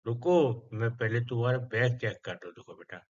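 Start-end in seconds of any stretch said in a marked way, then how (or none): none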